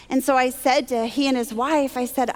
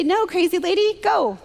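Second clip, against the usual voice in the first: higher-pitched mock dismissal; imagined rebuff; 6 semitones higher than usual. Imagining the man turning her away, mock dismissive. Imagined brusque rejection from stranger.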